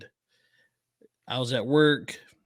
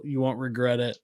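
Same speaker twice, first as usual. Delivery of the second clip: ordinary talk, nothing marked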